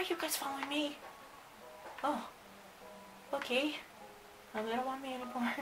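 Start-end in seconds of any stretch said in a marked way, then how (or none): none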